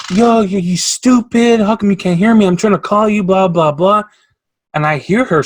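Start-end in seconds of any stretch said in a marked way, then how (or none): 0.1-4.0 high, loud mock yelling at a coworker; loud, high imitation of a customer yelling; imitating a screaming customer. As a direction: ham up the rude shouting lady. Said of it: "this lady was screaming at him".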